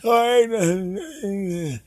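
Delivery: put-on high, slow old dad voice; clueless dad. Dad giving up the phone to his son, high and unsure. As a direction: play the fumbling father handing over the phone. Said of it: "You go up to them".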